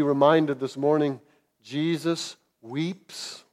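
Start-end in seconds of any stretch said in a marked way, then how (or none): 1.6-3.4 slow, soft and tender; a hushed comfort to sufferers. Real, not acted. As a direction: speak it gently and very slowly.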